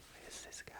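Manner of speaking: barely audible, imitating a puzzled coach; hushed mock aside from a serious coach; baffled coach's whisper. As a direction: whisper it under your breath in disbelief. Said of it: "Just like that".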